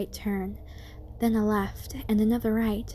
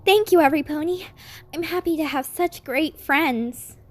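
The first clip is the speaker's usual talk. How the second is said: louder, higher, heartfelt thanks; grateful; 7 semitones higher, 6 dB louder than usual. Acted. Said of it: "Twilight was so grateful".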